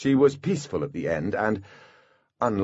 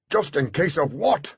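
higher, mildly raised shout; understated shout of alarm; shouted question; 6 semitones higher than usual. A narrator's reading. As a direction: call the question out urgently. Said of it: "Butler shouted".

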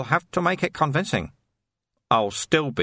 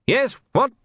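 much higher and louder, testy officer; impatient snap; 13 semitones higher, 4 dB louder than usual. A narrator's reading. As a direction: bark back at the interruption. Captain snaps at interrupting jailer.

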